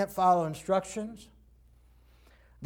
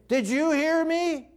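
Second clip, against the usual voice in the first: higher, drawn-out checking question; slow it down to make sure it lands; insistent parent; 6 semitones higher, slower than usual. Acted. Parents checking the child heard the instruction, insistent.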